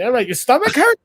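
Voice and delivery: acted-out swaggering healer; getting cocky. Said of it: "getting a little cocky".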